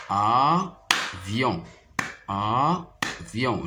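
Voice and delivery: "Avion" split as a, pause, vion; clap-along "Avion" in two separate beats; syllable by syllable. Shown as a demonstration. Teacher tapping out syllables for pupils, syllable by syllable. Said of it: "je frappe les syllabes".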